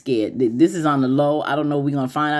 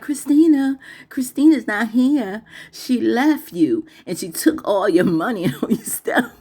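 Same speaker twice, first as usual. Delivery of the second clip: higher, mocking taunt; movie line; 6 semitones higher than usual. Wife taunting husband in the movie, higher mocking voice. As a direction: taunt him with the bad news. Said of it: "and she was like".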